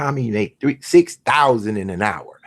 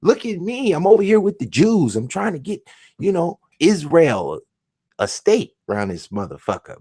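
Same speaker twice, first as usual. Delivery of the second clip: mock showoff; 6 semitones higher than usual. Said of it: "I'll be like".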